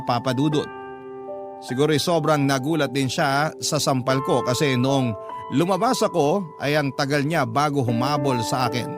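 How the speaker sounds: ordinary talk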